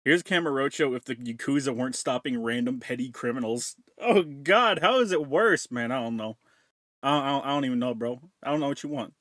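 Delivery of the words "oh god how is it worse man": loud, high disbelief; shocked. Real, not acted. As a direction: recoil at how bad it is. Reacting to the video.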